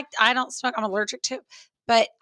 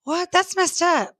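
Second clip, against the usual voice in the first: higher and louder, hammed-up indignation; mock outrage; 9 semitones higher, 3 dB louder than usual. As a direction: play up the offended protest. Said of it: "And I was like".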